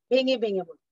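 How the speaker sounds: normal talking voice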